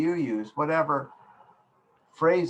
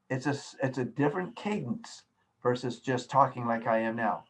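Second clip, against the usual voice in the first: ordinary talk, nothing marked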